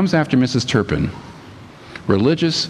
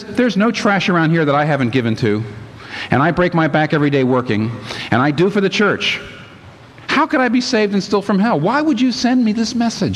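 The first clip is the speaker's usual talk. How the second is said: high, indignant complaining voice; indignant churchwoman; 7 semitones higher than usual. Retold or imitated. Mrs Turpin protesting to God, high indignant voice. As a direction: whine up high, offended at God.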